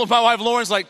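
ordinary talk

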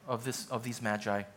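low, hushed prayer voice. Acted. Pastor praying before the sermon, quiet and reverent. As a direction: pray it quietly to God.

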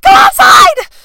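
piercing high yell; shrieking command in a desperate hurry; shout. Retold or imitated. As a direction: bark the order at full volume. Ordering boyfriend onto balcony.

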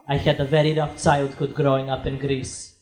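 put-on low Greek accent, confiding. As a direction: imitate a Greek immigrant father, pitched low. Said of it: "this cute little Greek accent".

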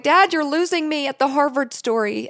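a daughter mock-groaning at a retold story; teasing exasperation. Teasing dad about his story.

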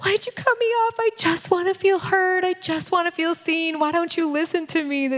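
hammed-up pleading to be heard; whiny hurt wife. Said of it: "I'm like".

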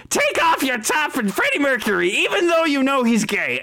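high, fast screech; second witch voice. Acted. Another witch butting in, high fast screech. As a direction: rattle it out high and frantic. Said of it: "you hear another voice go".

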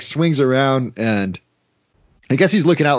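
ordinary talk

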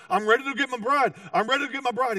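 high, eager groom bursting to go; fired up. Retold or imitated. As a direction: imitate an impatient, overexcited groom. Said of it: "the groom's so fired up".

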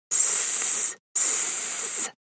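soft hissing S; snake hiss. Shown as a demonstration. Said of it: "That's the sound of a snake in Chinese".

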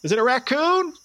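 high, loud, panicky squeak; startled high-pitched nervous voice; scared Derek. Retold or imitated. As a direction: Derek jumping at a noise in the house. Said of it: "and he's just like".

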